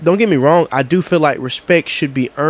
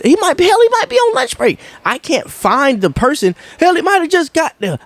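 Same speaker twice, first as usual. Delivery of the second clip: exasperated; 14 semitones higher than usual. Real, not acted. Exasperated rant about contractor.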